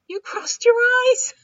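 very high, loud cackling bird; laughing cawing crow. Acted. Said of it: "The crow laughed".

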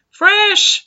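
shrill high-pitched sales shout; loud, very high hawker's cry; annoying high pitch. Acted. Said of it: "his voice reaching an annoying pitch".

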